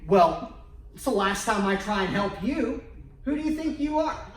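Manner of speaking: loud, high, huffy outburst, laughing through it; mock indignant outburst. Husband snapping back at upset wife, mock indignant shout. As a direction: blow up in hammed-up offense. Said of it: "and you're thinking".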